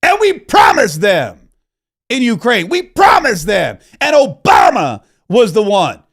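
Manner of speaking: imitating a yelling TV pundit; mock screaming rant. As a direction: ham up a shouting sports pundit. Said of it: "should I start screaming like that".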